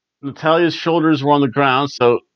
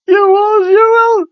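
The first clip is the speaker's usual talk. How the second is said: shrill, much higher and louder mock Scottish accent; Scottish accent impression; 8 dB louder than usual. Wrestler on the mic scolding the crowd, Scottish accent yelled high. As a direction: squeal it out in a wild Scottish accent, way up high and loud. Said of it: "I can't speak in a Scottish accent".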